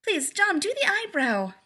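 high, eager begging; hopeful. A narrator's reading. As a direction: plead with bouncy hope. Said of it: "she bounced hopefully".

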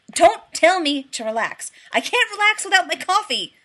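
loud, high frantic outburst; almost shrieking. A narrator's reading. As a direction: rise to a near shriek. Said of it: "Rodney almost shrieked".

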